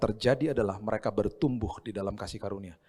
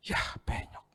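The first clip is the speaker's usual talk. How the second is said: whispered sigh over the damage; disappointed whisper; 7 dB quieter, much slower than usual. Acted. Father seeing the dented car, whispered dismay. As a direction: breathe it out, deflated.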